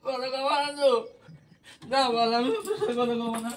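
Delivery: wailing demand for an answer; real crying. Real, not acted. Husband asks if wife won't talk, crying. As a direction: cry out the question, hurt. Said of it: "বাবাই কান্না করে দিয়েছে".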